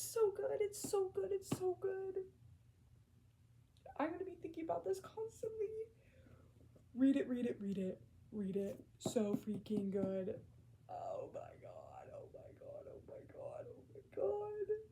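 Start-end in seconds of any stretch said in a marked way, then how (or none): 0.0-2.2 high, loud repeated praise; ecstatic gush. Real, not acted. Gushing about the book. 4.0-5.7 high, fast, half-crying whisper; whimpered ache. Real, not acted. Emotional after finishing book. 10.9-14.8 overcome whisper to squeak. Real, not acted. Overcome after finishing book.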